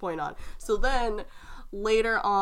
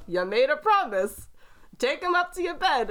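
insistent dad; 5 semitones higher, 4 dB louder than usual. Retold or imitated.

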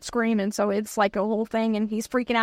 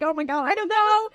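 giddy; 7 semitones higher than usual. Retold or imitated. Answering Julie, giddy.

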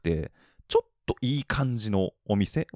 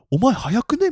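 高めで強く問い詰める; 怪しむように声を張る; 呆れた店員の声; 13 semitones higher, 6 dB louder than usual. Retold or imitated. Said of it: "みたいな". (店員が早すぎる客に、呆れて大きく)

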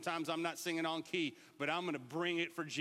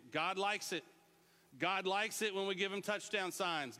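usual speaking voice

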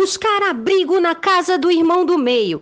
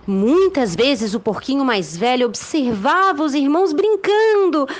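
lively storybook narration; reading to children; 3 semitones higher than usual. A narrator's reading. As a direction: tell it like a bedtime tale.

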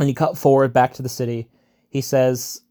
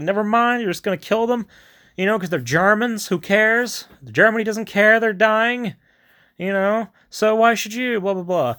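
high-pitched mock pep talk; energetic, hammed-up officer's briefing; commander's prep talk; 8 semitones higher than usual. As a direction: imitate a commander briefing the troops. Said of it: "giving prep talks to the guys".

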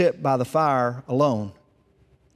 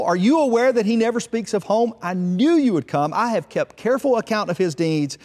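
mild narrated shout; 6 semitones higher than usual. A narrator's reading. Fault-finding son accusing his brother to the firstborn, mild shout. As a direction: denounce with raised pitch, short of yelling. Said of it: "he shouted".

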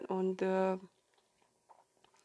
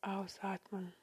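soft spa whisper; 9 dB quieter than usual. Acted. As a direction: whisper them gently into calm. Leads a guided meditation.

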